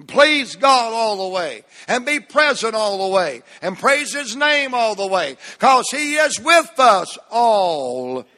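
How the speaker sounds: fervent pulpit refrain; impassioned preaching. Real, not acted. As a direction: proclaim it to the whole congregation. Preacher proclaims verse and refrain.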